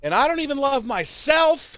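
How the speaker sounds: co-host piling on the tantrum, over the top; mock teen wail. Joins mock Dawn tantrum.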